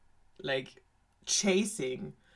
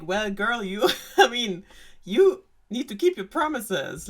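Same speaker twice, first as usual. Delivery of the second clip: higher, louder scolding father; stern king; 4 semitones higher, 6 dB louder than usual. Retold or imitated.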